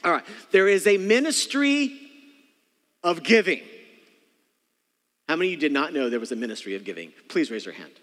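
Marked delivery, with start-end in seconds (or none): 0.5-3.6 loud proclamation. Acted. Pastor declaring to the congregation, loud preaching. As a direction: declare it from the pulpit.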